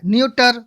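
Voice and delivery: "न्यूटर" with hard T where CH belongs; wrong pronunciation. Shown as a demonstration. Teacher showing how people misread written nature, nyu-tar mispronunciation. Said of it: "इस तरीके से नहीं पढ़ेंगे".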